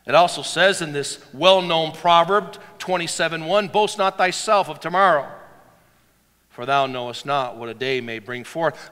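loud, high preacher's declamation; full-force preaching. Acted. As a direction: proclaim the verses to the whole church.

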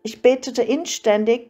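ordinary delivery

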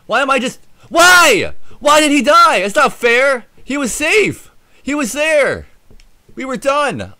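high, loud, anguished; frustrated shout. Real, not acted. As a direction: yell at the unfair game. Dies again, shouting.

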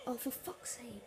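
whispered curse under breath; frustrated whisper. Real, not acted. Frustrated after foul.

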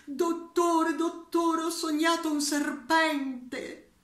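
high, loud urgent plea; anxious patient. Acted. Patient begging the psychiatrist about a dream, high and loud. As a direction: call out to the doctor in a fluster.

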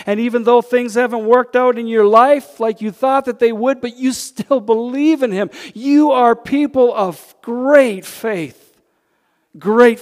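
loud, high preacher's exhortation; preaching at full force. Acted. Preacher rousing his congregation, loud full-force preaching. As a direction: lift the room, preach it out.

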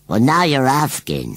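slow, high rustic voice; evasive rustic scarecrow. A narrator's reading. Scarecrow dodges Susan's question about the baby, evasive. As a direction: play a scarecrow dodging the question.

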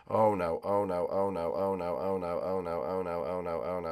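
low, soft repeated fretting; muttered worry. Real, not acted. Gamer stuck in a cobweb, low muttered worry. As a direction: fret under your breath in a steady chant.

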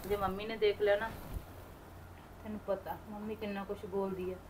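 softly, almost under her breath; hushed worry. Acted. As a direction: keep it low so mum won't hear. Sick sister fears scolding mother. (ਡਰ ਨਾਲ ਹੌਲੀ ਆਵਾਜ਼)

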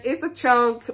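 ordinary talk

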